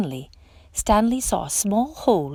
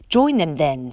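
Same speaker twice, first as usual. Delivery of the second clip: louder, lower snarl; 3 semitones lower, 4 dB louder than usual. A narrator's reading. Lobster sending Stanley away with a snarl. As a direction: snap it off dismissively. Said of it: "snarled the lobster".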